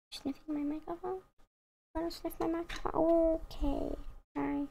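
high, soft questioning; cooing to a pet. Real, not acted. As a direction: coo softly to a cat at the microphone. Talking to the cat.

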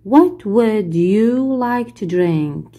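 each English word spelled out slowly for the class; very slow model reading. Shown as a demonstration. Teacher playing flight attendant, very slow model question. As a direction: read it slowly so pupils can repeat. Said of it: "واحد بيكون هو المضيف للطيران".

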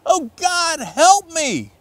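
high, panicked cry for help; cried out in panic. Acted. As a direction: yelp it out as he flies through the air. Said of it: "he cried out".

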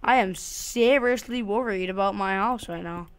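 ordinary talk

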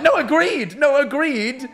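high, squeaky flustered agreement; comic incredulous protest, very high; flustered disbelief. Retold or imitated. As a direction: agree frantically, then shoot up into disbelief. Retelling his flustered, disbelieving agreement.

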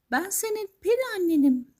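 very high magical lady voice; high, assured fairy voice; fairy godmother. Acted. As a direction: promise it in a high storybook voice. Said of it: "demiş kadın".

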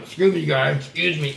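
low, dainty apology after a burp; mock polite excuse me.

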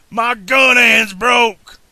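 high, drawn-out shout of pain; slow, anguished outlaw howl; wailing. A narrator's reading. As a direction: wail loudly over an injured hand. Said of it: "Buford wailed".

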